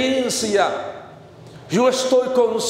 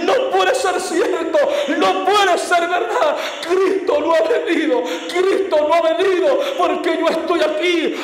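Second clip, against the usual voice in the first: desperate denial; 5 semitones higher than usual. Retold or imitated. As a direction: cry out in panicked disbelief. Narrator in shock, denial. (incredulidad angustiada y desesperada)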